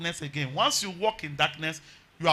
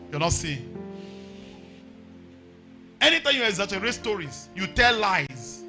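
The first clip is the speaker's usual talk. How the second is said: raised, ringing preacher voice over music; hollering revival preacher; 3 semitones higher than usual. Acted. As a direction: preach it out, climbing higher.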